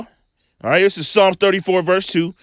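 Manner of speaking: ordinary talk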